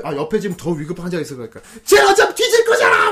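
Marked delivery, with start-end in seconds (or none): 1.9-3.1 very high, loud angry yell; mock abusive outburst. Patient resenting a more urgent case, hammed-up shout. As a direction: lash out as a pain-maddened patient. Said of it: "막 욕을 막 한다거나".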